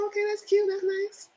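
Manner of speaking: high sing-song "okay, that's cute"; high, syrupy mock approval; mock sweet approval. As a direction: pipe it up high like polite praise. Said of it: "I said".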